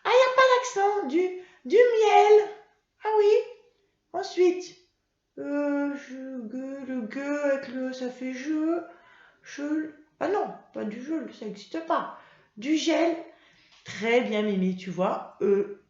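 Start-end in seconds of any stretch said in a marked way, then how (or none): 0.0-2.5 excited discovery. Acted. Puppet Mimi finding the word, loud high excitement. 3.0-13.2 put-on high, childlike puppet voice sounding out words; a child puppet guessing words aloud, higher; Mimi puppet voice. Teacher voicing Mimi the puppet for pupils, high childlike voice.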